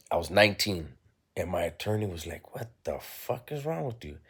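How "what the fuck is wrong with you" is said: high, hushed mock disbelief; appalled disbelief. Attorney imitated reacting to his client's demand, appalled. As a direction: imitate someone aghast, pitched up but soft. Attorney's retold shocked response.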